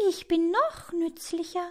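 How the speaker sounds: very high, put-on bee voice; high-pitched little show-off; boasting bee. A narrator's reading. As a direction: brag away in a tiny high voice.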